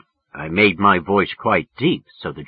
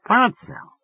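Birdsell said much higher, searching her memory; musing woman's voice; 14 semitones higher than usual. Acted. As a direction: turn the name over as she tries to place it. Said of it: "running the name over in her mind".

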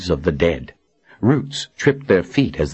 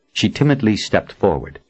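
ordinary delivery, nothing marked

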